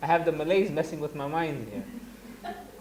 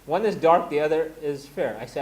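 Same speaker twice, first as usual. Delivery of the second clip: ordinary delivery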